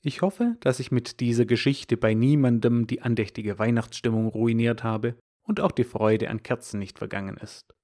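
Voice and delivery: usual speaking voice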